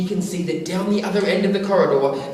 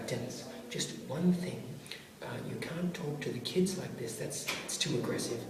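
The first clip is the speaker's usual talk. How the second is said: quiet word; 5 semitones lower, 12 dB quieter than usual. Retold or imitated. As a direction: have a hushed word with a colleague. Quietly correcting Dennis.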